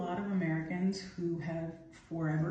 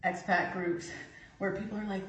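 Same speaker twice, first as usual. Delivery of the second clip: normal talking voice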